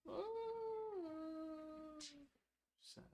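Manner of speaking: high cooing sympathy. Real, not acted. Parent cooing softly to a crying child.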